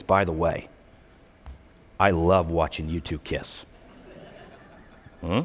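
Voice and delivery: low, hushed, creepy uncle voice. Retold or imitated. Uncle to newlyweds he barely knows, creepy confiding. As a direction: lean in low and confide it unsettlingly. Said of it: "gives you that down stare".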